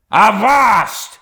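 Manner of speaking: explosive high shout of command; gritted fury. A narrator's reading. Captain halting his protesting mate, gritted furious shout. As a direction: cut him off with a furious yell through set teeth. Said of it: "gritted Ahab between his set teeth".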